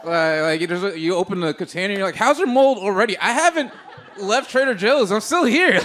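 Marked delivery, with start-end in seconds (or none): 2.2-5.8 high, loud, incredulous complaint; mock outraged shopper. Comic bit: shopper stunned by instantly moldy berries, shrill disbelief. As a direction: play the shocked shopper finding mold.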